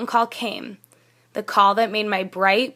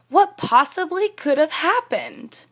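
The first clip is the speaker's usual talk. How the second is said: "What possibly could have happened" pitched high as a real question; questioning intonation; 7 semitones higher than usual. Shown as a demonstration. Teacher showing students it's a question, high questioning intonation on "What possibly could have happened". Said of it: "See the intonation in my voice?".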